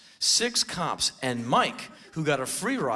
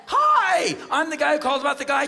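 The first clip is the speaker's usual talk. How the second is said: high, loud, rushed; excited phone greeting; 12 semitones higher, 7 dB louder than usual. Retold or imitated. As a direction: burst into the call eagerly. Acted excited second call to police.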